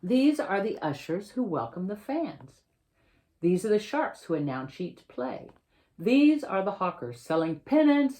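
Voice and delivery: picture-book reading, lively and clear; reading to children. Acted. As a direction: read the story to a class of kids. Author reads picture book aloud.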